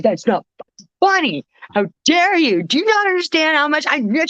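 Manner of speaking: high-pitched, hammed-up offense; mock indignant outrage. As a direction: play it as fake outrage at a friend. Indignant reply to friend's tease.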